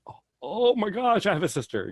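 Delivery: high, loud thrilled gasp of joy. Retold or imitated. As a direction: gush back just as excited. Her own joyful reply quoted.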